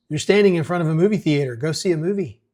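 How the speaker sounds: put-on loud, fast mayor scolding a bored teen; exasperated mayor. Mayor tells a bored teen to go see a movie, loud and fast. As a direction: snap the obvious at him.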